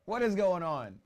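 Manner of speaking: loud and high, excited; excited shout. Real, not acted. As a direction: shout it in disbelief. Reacting to goals.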